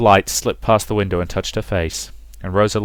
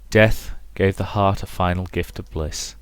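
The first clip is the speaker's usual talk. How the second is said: normal talking voice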